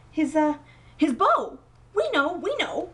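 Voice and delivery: flustered bluffing. A narrator's reading.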